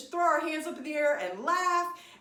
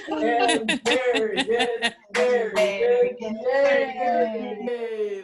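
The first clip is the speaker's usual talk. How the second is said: stretch and laugh; 5 dB louder, much slower than usual. Shown as a demonstration. Teacher laughing loudly while stretching with the class. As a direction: laugh out as you reach across. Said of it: "and just laugh".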